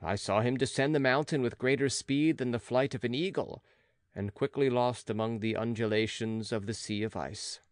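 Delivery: usual speaking voice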